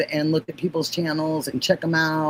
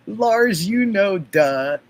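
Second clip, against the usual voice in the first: sassy duh; 3 semitones higher, 4 dB louder, slower than usual. Shown as a demonstration. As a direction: give the duh a playful sassy punch. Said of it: "Am I doing it right?".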